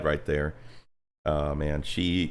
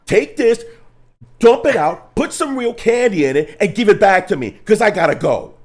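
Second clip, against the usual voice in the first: much higher, louder, an indignant mock rant; retold angry demand; 13 semitones higher, 10 dB louder than usual. Telling the counter staff off, high and loud. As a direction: bark the order like an offended customer.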